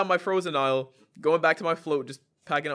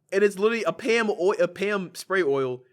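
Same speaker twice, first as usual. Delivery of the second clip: ordinary talk, nothing marked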